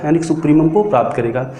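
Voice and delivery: usual speaking voice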